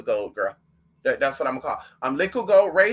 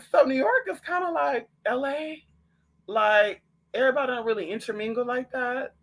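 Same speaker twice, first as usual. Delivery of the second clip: surprised question; 6 semitones higher than usual. Retold or imitated. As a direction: ask it up high in disbelief. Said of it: "And I was like".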